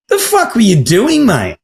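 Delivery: high disbelieving cry, then dropping on the last word; mock-shocked friend asking what he was thinking; incredulous exclaim. Friends who know him, shocked at the footage: high incredulous cry. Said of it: "everybody that knows him will be going".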